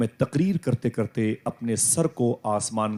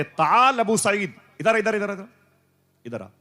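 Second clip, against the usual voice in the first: high, louder beckoning call; 9 semitones higher, 4 dB louder than usual. Acted. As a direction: call him over eagerly. Saint beckoning Abu Saeed close.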